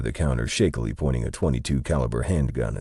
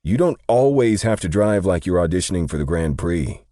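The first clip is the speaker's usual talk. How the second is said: raised, louder scolding that settles toward the end; huffy complaint; 5 semitones higher, 5 dB louder than usual. A narrator's reading. Day scolding his partner's driving, huffy and louder. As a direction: chide a bad driver with exasperation. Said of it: "Day huffed".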